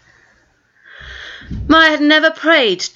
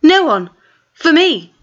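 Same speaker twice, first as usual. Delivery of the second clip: flustered; 8 semitones higher, 4 dB louder than usual. Acted. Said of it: "more and more flustered".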